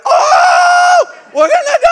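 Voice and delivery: very high, loud mock panic scream; panicked yell. Pastor retelling the fire alarm chaos, in a high mock panic yell. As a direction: shriek like the building is burning. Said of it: "and I'm like".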